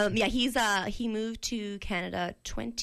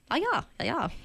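fake German; 6 semitones higher than usual. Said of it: "the extent of your accents".

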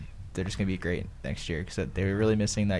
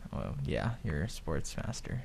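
sarcastic deadpan; 4 semitones lower, 8 dB quieter than usual. Acted. Teasing praise of "sports master.

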